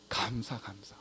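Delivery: 낮고 작게 속삭이듯; 비밀 무기를 알려 주듯 소곤소곤; 속삭임. Real, not acted. 답을 속삭여 알려 줌. (나직이 속삭이기)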